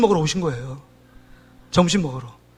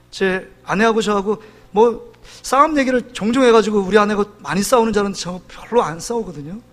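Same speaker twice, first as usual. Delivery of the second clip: ordinary talk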